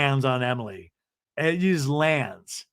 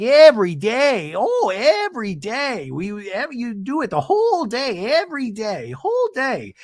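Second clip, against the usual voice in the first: milk every day of drudgery; imitating ranting Gina; 8 semitones higher than usual.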